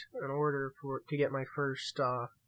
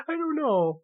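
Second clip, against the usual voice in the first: ordinary talk, nothing marked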